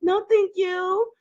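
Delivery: high, prim no-thank-you; polite refusal. Retold or imitated. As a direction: decline him sweetly but firmly. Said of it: "I'm like".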